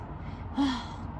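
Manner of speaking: long soft breathy sigh; weary sigh. A narrator's reading. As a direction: let out a tired mother's sigh. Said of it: "sighed Missus Large".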